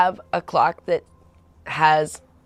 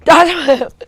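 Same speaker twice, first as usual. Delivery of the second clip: higher, louder barked "Doesn't work."; mock trainer yell; 11 semitones higher, 12 dB louder than usual. Trainer boyfriend yelling at girlfriend mid-squats, mock yell. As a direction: yell it like a drill coach. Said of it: "Be yelling at me".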